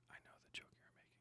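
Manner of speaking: unvoiced knowing aside; whisper. Real, not acted. Streamer answering a chat joke, whispered.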